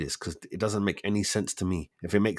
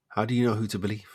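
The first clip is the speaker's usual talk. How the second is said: ordinary talk, nothing marked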